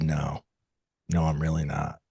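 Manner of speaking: low, soft retold admission; downcast admission. Customer admitting to the cashier he isn't okay, quietly downcast. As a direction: quietly give up pretending, voice dropped.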